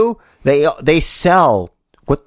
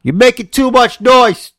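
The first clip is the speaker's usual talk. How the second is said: high, raised-voice scolding; pitched-up yell of complaint; calling out a complaint; 9 semitones higher, 4 dB louder than usual. Real, not acted. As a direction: holler it across the room. Calls out over noise, mock scolding.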